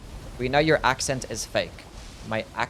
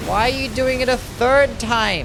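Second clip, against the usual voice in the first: high, loud, drawn-out complaint; real frustrated outburst, pitched way up; exasperated yelling; 11 semitones higher, 4 dB louder, slower than usual. Real, not acted. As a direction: wail it in disbelief at the game. Exasperated at boss repeating its attack.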